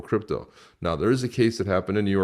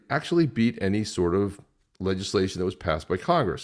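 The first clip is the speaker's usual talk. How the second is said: ordinary talk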